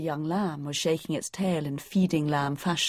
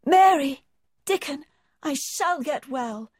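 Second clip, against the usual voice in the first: joyful cry fading; 9 semitones higher than usual. A narrator's reading. Colin tells Mary and Dickon he will live, ringing then fading. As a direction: start with a ringing call and fade to a whisper of hope. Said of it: "he cried out".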